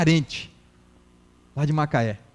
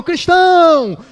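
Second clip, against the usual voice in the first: high, loud, drawn-out cry; mock congregation shout; 9 semitones higher, 10 dB louder, much slower than usual. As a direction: imitate shouting it out in church. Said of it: "Grita, grita".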